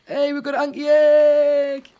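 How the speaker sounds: loud, high, drawn-out cry of delight; excited shout. Real, not acted. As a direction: shout it out, thrilled at the find. Excited find of a rare egg.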